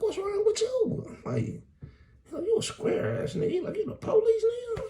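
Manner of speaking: squeaky high security guard; mock high-voiced security guard. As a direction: play the guard in a high mocking voice.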